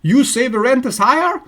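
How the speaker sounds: mock irritated outburst. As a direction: sputter in disbelief at the rent. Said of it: "Anger, irritation, impatience".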